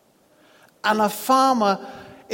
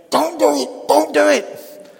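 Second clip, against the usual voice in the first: high, loud panicky squawk of a warning; chickens shouting; 8 semitones higher, 8 dB louder than usual. Acted. Chickens beg the eagle not to jump, high panicked shouting. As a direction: yell it high like frantic chickens.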